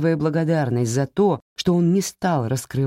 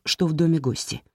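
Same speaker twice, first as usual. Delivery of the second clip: ordinary delivery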